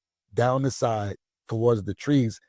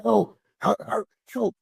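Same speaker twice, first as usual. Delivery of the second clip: much higher mock cries of help; retold cries for help; 11 semitones higher than usual. Trapped passenger calls for help, higher mock cries. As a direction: echo a trapped person calling out. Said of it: "And they yelling".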